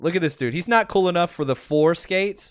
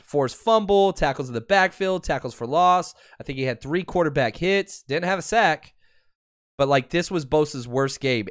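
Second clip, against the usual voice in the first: ordinary talk, nothing marked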